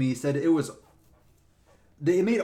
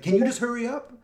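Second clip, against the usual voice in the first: higher mock impatience; impatient; 9 semitones higher, 4 dB louder than usual. Cyclist hurrying the ranger, impatient and higher.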